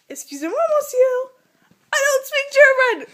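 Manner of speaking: high, loud tourist plea; flustered mock protest. Replying to man yelling at her.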